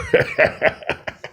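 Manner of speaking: breathy high villain laugh; short sinister laugh; evil laugh. Acted. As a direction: cackle as the queen. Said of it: "smiles and laughs".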